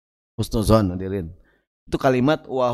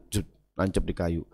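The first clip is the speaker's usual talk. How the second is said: soft mock nail sound; 10 semitones lower, 10 dB quieter than usual. Sound effect of nail driving.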